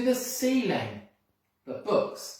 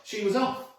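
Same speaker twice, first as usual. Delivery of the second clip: ordinary delivery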